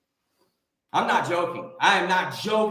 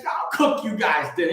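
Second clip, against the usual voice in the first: normal talking voice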